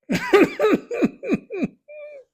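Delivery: high, helpless laugh near tears; crying laughter. Real, not acted. Host retelling a guest's bad basketball take, tearful high laughter. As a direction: laugh till it almost breaks into crying. Guest calls Gordon great shooter.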